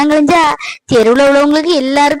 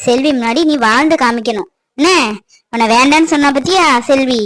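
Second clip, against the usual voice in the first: normal talking voice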